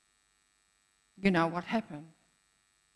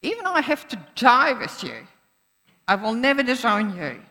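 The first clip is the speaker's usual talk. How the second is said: louder, higher, defiant promise; bold vow; 4 semitones higher, 7 dB louder than usual. Acted. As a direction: declare it boldly, chest out. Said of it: "But Peter said".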